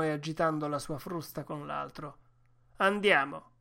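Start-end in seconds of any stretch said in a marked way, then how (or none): 2.8-3.5 loud, raised call to the dogs; shouted command. A narrator's reading. Driver starting his sled dogs, shouted command. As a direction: urge the team on with a shout. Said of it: "gridò".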